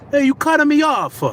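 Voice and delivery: put-on high, loud Italian-accented complaint; indignant mock Italian accent; imitated angry Italian. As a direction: accuse like a hammed-up Italian driver. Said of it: "He's Italian".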